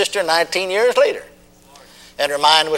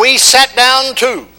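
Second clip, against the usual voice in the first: loud, high, very slow call for the crowd to repeat; preaching call; 8 semitones higher, 5 dB louder, much slower than usual. Acted. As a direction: chant it out line by line. Call and response.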